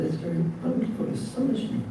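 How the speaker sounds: lightly voiced wonder, quick and high; a hint of playful astonishment; amazed. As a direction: marvel at it, a touch mock-astonished. Krishna marvels at Rukmini.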